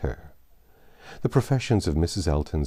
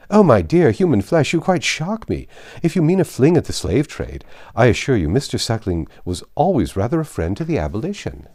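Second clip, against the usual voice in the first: higher, louder scandalised matron; mock shock; 6 semitones higher, 6 dB louder than usual. Matron scandalised by a young woman's remark, shocked. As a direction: gasp at her words, hammed-up.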